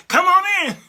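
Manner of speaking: loud, high, cheering welcome; mock crowd shout. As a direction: belt it out like a crowd waving him in. Voters welcoming Trump in.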